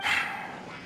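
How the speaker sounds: weary sigh. Acted. Worker concedes search is hopeless.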